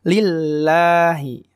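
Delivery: slow Lillahi with light "la"; thin lam. Shown as a demonstration. Teacher returns to correct Lillahi, thin lam. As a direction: chant Lillahi, holding a thin double l. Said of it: "Tipis ya".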